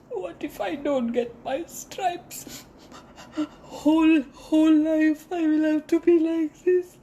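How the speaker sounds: very high sobbing plea, rising to a wail; crying plea. A narrator's reading. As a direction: zebra begging for her stripes in tears. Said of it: "and Zebra".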